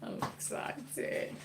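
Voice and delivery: slow, hushed whisper; whispered excitement. Real, not acted. Cook confiding she is excited while waiting, whispered. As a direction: whisper it slowly like a little confession. Playful aside after admitting excitement.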